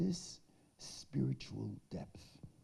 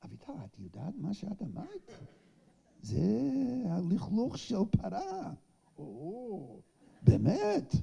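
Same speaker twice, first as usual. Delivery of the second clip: fast, higher, playful Hebrew banter; teasing Hebrew exchange; 9 semitones higher, 5 dB louder, faster than usual. Retold or imitated. בהתרגשות מתגרה. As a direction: rib her lightly, then her surprised reply. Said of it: "I said".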